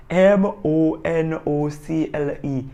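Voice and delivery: slow letter by letter spelling. Shown as a demonstration. Vlogger spells the word for viewers, slowly. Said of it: "spells the word".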